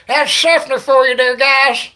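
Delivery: high, loud crowd-pumping yell; loud hyped shout. Real, not acted.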